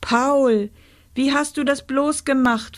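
high, mildly awed question; awestruck. A narrator's reading. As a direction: ask in wonder. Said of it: "fragte sie ehrfürchtig".

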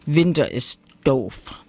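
lightly voiced bear grumble; a hint of bear growl; grumbling little bear. A narrator's reading. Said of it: "meckerte der kleine Bär mit einem tiefen Bärenbrumm".

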